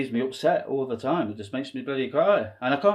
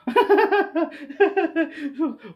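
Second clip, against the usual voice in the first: high burst of laughter; 11 semitones higher than usual. Real, not acted.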